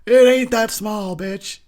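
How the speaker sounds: high, loud mock protest; a hammed-up indignant shout; mock yell. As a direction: yell it back in fake outrage. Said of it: "You're like".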